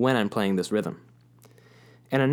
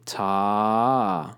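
held note. Shown as a demonstration. Teacher showing a long note, slow. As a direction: stretch ta across two beats. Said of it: "keep the ah sound going through the next beat".

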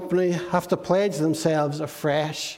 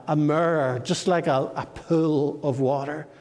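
normal talking voice

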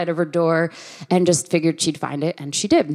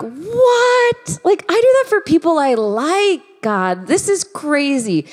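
much higher, louder, mock-shocked protest to God; incredulous outburst; 10 semitones higher, 4 dB louder, slower than usual. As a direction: squeal your disbelief at God. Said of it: "I'm like".

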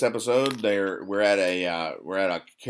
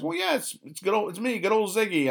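much higher, eager little-guy voice; Ziggy voice; 12 semitones higher than usual. Acted. Ziggy reminding his old coworker who he is, high eager voice. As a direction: pitch up as a hopeful cartoon nobody. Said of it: "And he goes".

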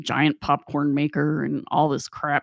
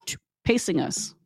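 usual speaking voice

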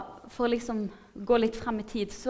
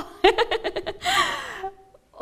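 long, loud, high-pitched laughter; big squealing burst of laughter; delighted laugh; 13 semitones higher, 8 dB louder than usual. Real, not acted. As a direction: crack up at your own surprise. Laughs at her own astonishment.

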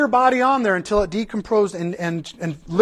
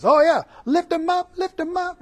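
higher, bright cheer of "lift him up"; mock praise cheer; 9 semitones higher than usual. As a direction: hype it like a worship chant. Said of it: "it's a positive phrase".

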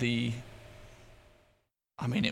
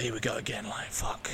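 quietly cursing to himself as the emotions return; weary whispered curse; 2 semitones lower, 6 dB quieter than usual. Retold or imitated. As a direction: drop to a resigned whisper on the curse. Dreading another crying wave.

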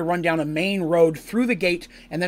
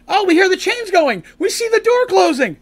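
high, louder urgent calling; excited alarm; 13 semitones higher, 6 dB louder than usual. Retold or imitated. Friends running toward the closing door, urgent high call. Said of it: "they're like".

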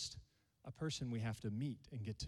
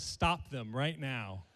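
loud, emphatic order to halt, building to a shout; hammed-up demand, each word punched louder; mock stern command; 10 dB louder, much slower than usual. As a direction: call a halt in mock outrage. Voicing Jamie's mock demand to stop.

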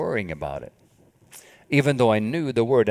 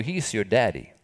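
ordinary delivery

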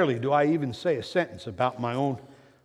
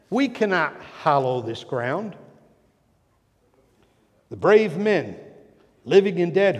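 oratory building up; 7 dB louder than usual. Shown as a demonstration. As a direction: start measured, then build to a louder, ringing proclamation. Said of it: "I want to read these two hundred and seventy words".

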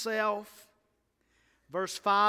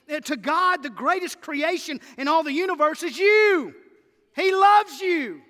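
higher and louder, rolling list of words; hammering each adjective to the church; full-force preaching; 8 semitones higher, 10 dB louder than usual. Real, not acted. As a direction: build the list like a revival preacher.

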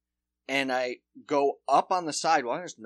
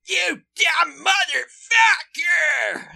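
much higher, louder, slow yelled insult; furious shout; 9 dB louder, much slower than usual. Retold or imitated. Cyclist yelling at the comedian on the sidewalk, a slow high shout. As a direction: scream it at someone blocking the sidewalk. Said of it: "He goes".

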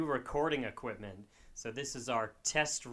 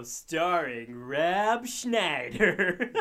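movie trailer voice; 4 semitones higher, 9 dB louder, much slower than usual. Pretend. Mock movie trailer joke.

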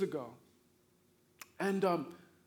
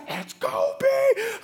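excited shout; 13 semitones higher, 7 dB louder than usual. Retold or imitated. Spotting a celebrity, excited.

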